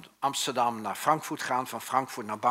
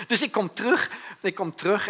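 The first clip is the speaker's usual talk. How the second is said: high and loud through laughter; laughing; 9 semitones higher, 6 dB louder than usual. Real, not acted. Telling the church about coming back, laughing high and loud. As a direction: laugh your way into the next bit. Laughs, then retells excitedly.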